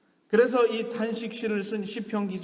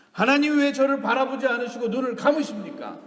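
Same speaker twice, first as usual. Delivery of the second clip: louder, higher-pitched challenge to God; acted psalmist's raised lament; irreverent protest; 6 semitones higher, 7 dB louder than usual. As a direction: confront God with a complaint. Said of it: "아주 불경한 소리로 하나님께".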